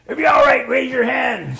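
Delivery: loud, high shouted order; calling out. Retold or imitated. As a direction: shout it to the injured rider. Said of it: "the guy kept saying".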